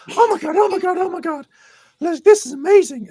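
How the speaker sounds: squealing high and loud, overjoyed; excited fan impression. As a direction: gush breathlessly in a very high voice. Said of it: "this is literally how he's, uh, how he's speaking".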